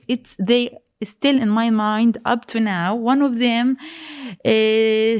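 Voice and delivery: usual speaking voice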